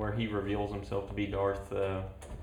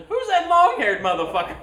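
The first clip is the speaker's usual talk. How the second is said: much higher, loud mock outrage; mock shout; 9 dB louder than usual. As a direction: yelp it in shocked disbelief. Voicing Vader meeting young self.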